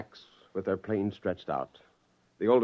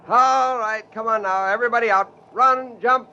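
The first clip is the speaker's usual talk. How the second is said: higher, louder urging call; 11 semitones higher, 6 dB louder than usual. Acted. Scientist urging creatures out of the jeep, calling loudly. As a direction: cheer them out into the open.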